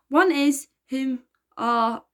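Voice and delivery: slow, clumsy fake-posh English accent; a boy badly putting on a posh English accent; mock posh accent. A narrator's reading. Elliot tries to sound royal for the Queen, mock posh accent. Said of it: "attempting at talking posh".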